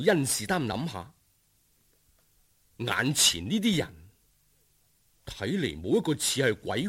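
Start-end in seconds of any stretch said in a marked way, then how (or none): none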